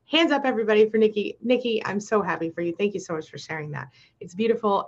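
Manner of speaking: ordinary talk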